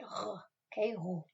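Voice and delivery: roux with a throaty back-of-the-throat r, said softly; throaty French r. Shown as a demonstration. Teacher showing pupils the throaty French r. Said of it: "get that nice throaty sound".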